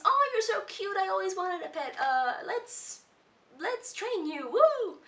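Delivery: gushing over a cute pet; excited Wander. Retold or imitated. Wander delighted to meet the monster, gushing high and excited. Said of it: "And Wander thinks".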